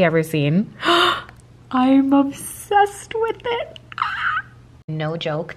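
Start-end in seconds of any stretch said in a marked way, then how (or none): 1.7-3.8 high stretched out gush; excited squeal. Real, not acted. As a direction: gush over it. Gazing at egg.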